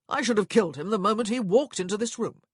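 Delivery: loud angry growl. A narrator's reading. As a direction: seethe with fury. Furious husband.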